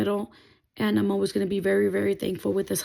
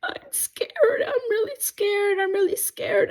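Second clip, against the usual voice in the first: high, tearful and breaking; reliving the tears, voice cracking up high; retold crying; 12 semitones higher than usual. Telling her husband on the phone, tearful and breaking. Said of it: "I just started crying and crying".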